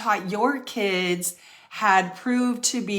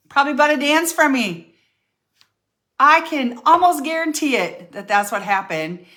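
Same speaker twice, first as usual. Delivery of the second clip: triumphant jab; 7 semitones higher, 7 dB louder than usual. Retold or imitated. Defiant retold speech to son.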